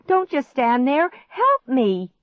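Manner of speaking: high, loud exasperated plea; urgent pleading. A narrator's reading. Girl urges frozen stepbrother, pleading. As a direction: urge him desperately to act. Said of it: "I pleaded".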